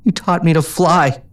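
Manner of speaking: put-on high, slow childlike plea; pleading. A narrator's reading. Pegasus pleading with mentor not to be left, childlike. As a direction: plead to change her mind. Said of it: "she pleaded".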